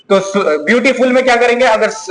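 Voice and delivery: ordinary talk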